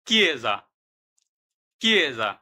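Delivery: কিয়েজা said slowly, as an Italian word for learners; Italian word demo. Shown as a demonstration. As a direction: sound out কিয়েজা clearly for the class. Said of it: "উদাহরণ".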